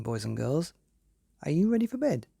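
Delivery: ordinary delivery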